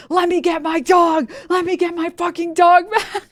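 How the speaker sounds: very high, screechy mock shout; hammed-up frantic screaming; imitated door-pounding yell. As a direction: yell like someone demanding in through a locked door. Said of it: "hitting the door and being like".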